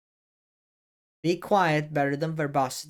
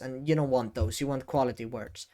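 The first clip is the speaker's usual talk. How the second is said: normal talking voice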